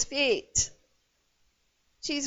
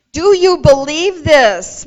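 loud, pressing question; emphatic challenge; 2 semitones higher, 11 dB louder than usual. Acted. Jesus challenges Martha on her faith, loudly insistent. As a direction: press for an answer at raised volume.

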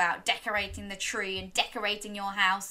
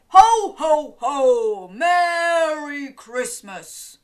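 loud, slow, jolly Santa voice; 5 semitones higher, 11 dB louder, much slower than usual. Shown as a demonstration. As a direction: boom it out like Father Christmas. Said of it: "Can you say".

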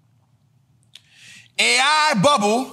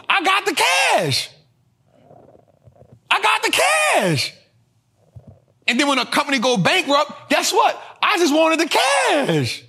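gloating yell; 5 semitones higher than usual. Retold or imitated. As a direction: crow it out like you just got paid. Broke company gloating after IPO.

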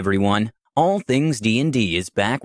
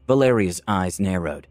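ordinary delivery

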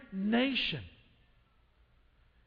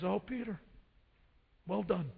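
soft tender praise; 7 dB quieter than usual. Acted. Jesus gently praises Peter.

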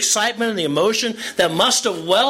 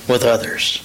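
usual speaking voice

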